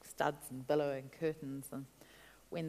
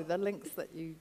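ordinary talk, nothing marked

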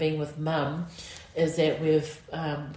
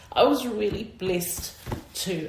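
ordinary talk

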